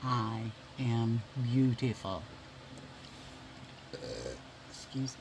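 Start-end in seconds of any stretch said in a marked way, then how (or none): 0.0-2.2 slowly spoken "I am beautiful"; slow, deliberate self-affirmation. Shown as a demonstration. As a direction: lead viewers in a slow affirmation. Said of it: "Say it with me".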